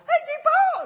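put-on a high, loud child's cry through a laugh; little high voice. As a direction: squeal it out like a happy three-year-old. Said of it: "he had his little high voice".

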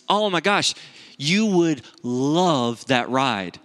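very slow, drawn-out gushing; hammed-up imitation of a raving mom; exaggerated enthusiasm. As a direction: oversell it to tempt a hesitant child, stretching each word. Wife raving to tempt son.

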